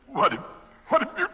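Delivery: high, loud, gloating; gleeful taunt. Acted. Victim taunting his poisoner, high gloating taunt. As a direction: savour the reveal.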